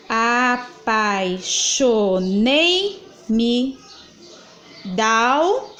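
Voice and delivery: very slow, drawing out each poetic syllable; slow syllable scansion. Shown as a demonstration. Teacher scanning verse for the class, very slow. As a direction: stretch the verse so every syllable can be counted. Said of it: "Vamos contar?".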